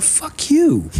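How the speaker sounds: high, dismissive fuck you; scornful retort. Retold or imitated. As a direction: spit it back at them mockingly. Mock retort to chiming-in people.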